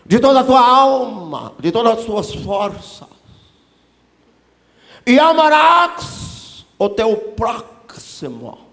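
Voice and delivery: loud, drawn-out mock-Hebrew recitation; hammed-up Hebrew pronunciation, easing off at the end. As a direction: declaim it high and slow in exaggerated Hebrew accent. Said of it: "lembra que ele respondeu em hebraico".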